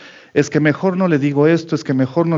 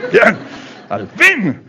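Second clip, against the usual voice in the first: mock relief; 6 semitones higher, much slower than usual.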